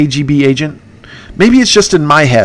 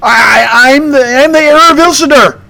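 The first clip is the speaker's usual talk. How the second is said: much higher, stammering declaration; squeaky mock conviction; Candace voice; 11 semitones higher, 3 dB louder than usual. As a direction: hero insisting he is the heir. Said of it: "he says with such conviction".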